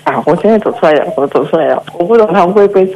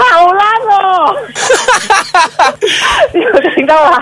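high laughing cry; embarrassed laughing; 10 semitones higher than usual. Real, not acted. Caller laughing at her own weak yell, embarrassed. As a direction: laugh at your own bad acting.

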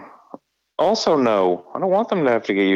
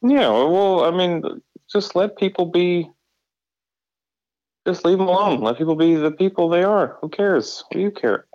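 exasperated; 8 semitones higher than usual. Real, not acted. Heated agreement.

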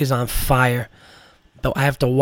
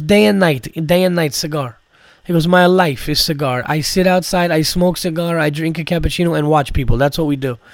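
Armenian accent impression. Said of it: "imitating this guy".

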